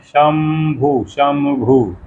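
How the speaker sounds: slow, careful शंभु with the nasal as m; pronouncing Shambhu. Shown as a demonstration. Teacher slowly demonstrating शंभु to students. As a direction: draw out शंभु, nasal sounded as m. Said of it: "उसको क्या पढ़ते हैं".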